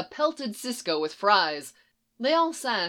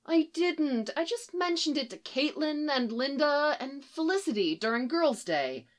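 high, soft innocent excuse; wide-eyed, wheedling self-defence; puppy-dog pleading; 8 semitones higher, 3 dB quieter than usual. A narrator's reading. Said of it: "eyes go big and puppy dog-like".

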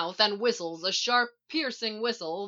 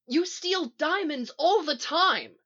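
high, drawn-out accusing shout; raised, exasperated accusation; 8 semitones higher, 4 dB louder than usual. A narrator's reading. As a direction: shout it in indignant disbelief. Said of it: "Sisko shouts".

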